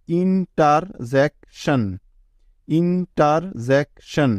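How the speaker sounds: Interjection drawn out slowly, each syllable apart; teacher slowly sounding out interjection. Shown as a demonstration. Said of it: "এভাবে ভেঙে ভেঙে উচ্চারণ করতে হবে".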